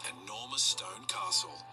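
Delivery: plain reading voice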